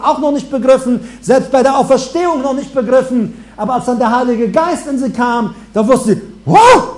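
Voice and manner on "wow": high, loud astonished wow; mock amazement; amazed wow. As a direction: burst out in sudden realization. Disciples realize in amazement.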